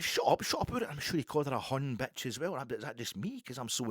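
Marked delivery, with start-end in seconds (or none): 0.0-0.9 high, louder imitation of snapping back; retold angry retort. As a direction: act out the man silencing her.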